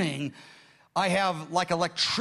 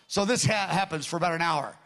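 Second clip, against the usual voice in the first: ordinary delivery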